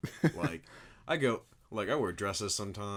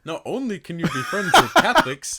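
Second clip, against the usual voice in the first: much louder, higher, laughing parody; mocking imitation; 13 semitones higher, 17 dB louder than usual. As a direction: exaggerate him as a preachy goody-goody. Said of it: "I did not talk like that".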